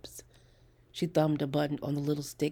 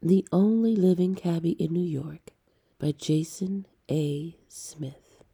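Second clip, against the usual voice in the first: big, loud announcer read of the title; booming title announcement; 8 dB louder than usual. Acted. Narrator opening the story for the audience, booming announcement. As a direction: proclaim the title like a show opener.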